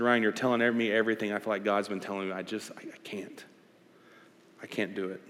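low, hushed, halting admission; retold tearful confession. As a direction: imitate a crying man, understated. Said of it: "he starts crying on the phone".

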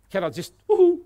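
loud high woohoo; excited mock whoosh; playful whoosh. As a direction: whoop as if whisked away. Philip vanishing, playful.

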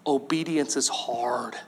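soft, low, slow: "Obedience is hard"; understated honest confession; quiet admission. Real, not acted. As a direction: confess it gently and slowly. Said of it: "Let's just be honest".

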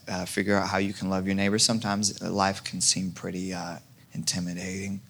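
ordinary delivery

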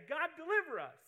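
high, loud cry to God; pleading prayer. Pretend. Students praying on their knees, loud pleading. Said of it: "get on their knees and say".

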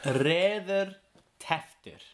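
reðurteftur drawn out very slowly, syllable by syllable; careful repeat. Shown as a demonstration. Learner repeating an Icelandic word, slow and careful. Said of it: "very good".

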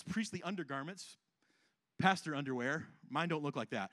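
usual speaking voice

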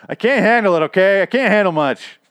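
loud, high-pitched overwhelmed complaint; exasperated outburst. Real, not acted. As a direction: burst out, fed up and overwhelmed. Overwhelmed rant about the show's timelines.

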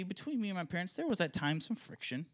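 usual speaking voice

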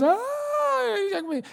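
long high mock wail; drawn-out child's scream impression; screaming back. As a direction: imitate a small child shrieking back. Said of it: "and he's screaming back".

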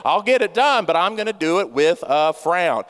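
normal talking voice

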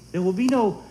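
ordinary talk

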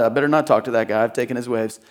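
low, quiet, quick inner voice; muttered thought. Retold or imitated. Pastor voicing a surfer's sheepish thought, low and quiet. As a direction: mumble it like a guilty thought.

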